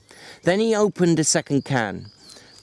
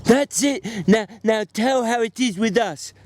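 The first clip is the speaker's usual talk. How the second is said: lightly voiced eager simple man; a bit higher, eager and pleading; a hint of childlike Lenny begging for the story; 9 semitones higher than usual. A narrator's reading.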